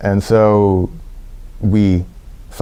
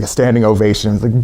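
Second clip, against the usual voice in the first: ordinary talk, nothing marked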